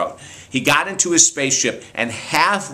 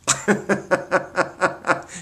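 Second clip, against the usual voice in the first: amused high laugh; 6 semitones higher, 4 dB quieter than usual. Real, not acted. Laughs at the girl's answer.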